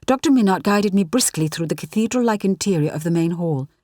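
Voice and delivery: ordinary delivery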